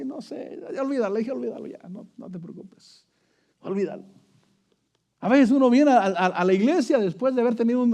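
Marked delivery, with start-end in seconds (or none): 0.0-4.0 much softer, almost murmured; a soft, tired "forget it"; hushed brush-off. Retold or imitated. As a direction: let it go quietly, telling him not to worry. Brushing off the supervisor, retold.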